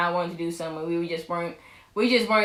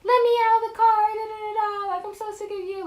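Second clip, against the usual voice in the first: high, fast singsong whine; hammed-up high-pitched complaint; mock dramatic outburst; 13 semitones higher than usual. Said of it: "I'm like".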